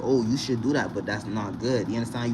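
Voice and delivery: normal talking voice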